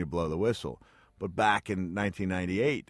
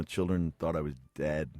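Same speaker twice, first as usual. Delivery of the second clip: ordinary delivery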